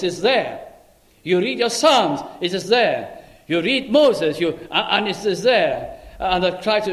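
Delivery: ordinary talk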